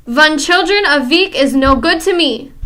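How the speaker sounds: loud, scornful witch outcry; crying out. Acted. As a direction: cry out in contempt. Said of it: "the Grand High Witch cried out".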